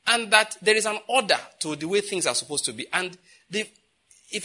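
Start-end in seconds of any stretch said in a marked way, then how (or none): none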